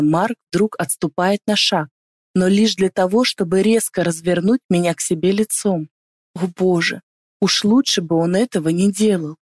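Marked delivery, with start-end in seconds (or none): none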